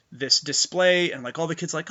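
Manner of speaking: ordinary talk, nothing marked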